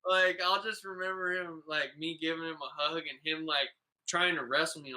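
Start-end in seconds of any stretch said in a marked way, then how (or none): none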